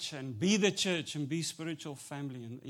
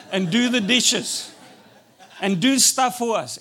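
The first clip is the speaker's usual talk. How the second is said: louder, driving sermon delivery; preaching; 3 semitones higher, 12 dB louder than usual. Acted. Pastor preaching to the church, louder full-force preaching. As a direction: preach it out to the congregation.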